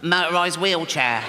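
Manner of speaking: put-on funny little child voice; comic sickly kid's voice; Timmy. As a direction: play a small boy with a bad chest. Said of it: "a funny little voice 'cause his all, chest is all fucked".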